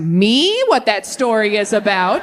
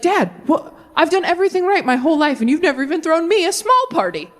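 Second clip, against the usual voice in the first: put-on indignant older son; 6 semitones higher than usual. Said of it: "He's like".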